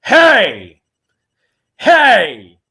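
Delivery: loud shouted hey, high and loud; loud shouted greeting across the convention floor; calling out. Retold or imitated. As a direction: call across the room to get his attention. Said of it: "I just hear this".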